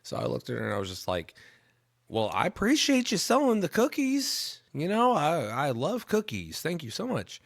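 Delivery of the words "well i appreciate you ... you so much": put-on high, loud gushing at a child; over-the-top thanks for a little kid; making a big deal. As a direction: gush as if cookies were a huge deal. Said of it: "you wanna make 'em feel like it's, like, a bigger deal".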